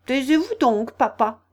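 put-on young crab snapping at father; a touch of peevish impatience; sour. A narrator's reading. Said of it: "d'un ton aigre".

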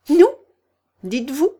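a mouse trembling with outrage; outraged mouse. A narrator's reading. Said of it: "s'écria la souris en tremblant". (souris outrée qui s'écrie, plus fort)

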